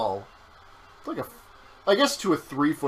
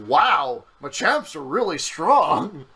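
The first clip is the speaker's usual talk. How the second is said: higher, drawn-out mock amazement; impressed professor; 5 semitones higher, 5 dB louder, slower than usual. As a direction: humour a child with exaggerated wonder. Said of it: "And the professor's like".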